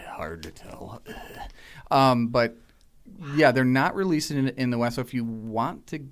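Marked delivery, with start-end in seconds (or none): 0.1-1.0 low whispered repeat; hushed aside. Acted. Podcaster repeating himself after watching YouTube clips, whispered. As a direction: say it under your breath, almost voiceless. Repeats own phrase under breath.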